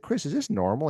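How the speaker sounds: retold puzzled question, pitch lifted; baffled question. Golfer asking his club fitter, baffled.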